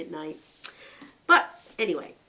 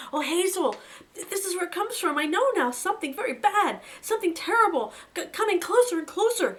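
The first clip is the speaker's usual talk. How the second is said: high, loud, stammering terror; frightened whimper; 13 semitones higher, 6 dB louder than usual. Acted. Fiver warning Hazel of coming danger, terrified. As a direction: voice climbing in panic as the dread closes in. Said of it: "He began to whimper with fear".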